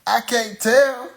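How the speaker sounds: ordinary delivery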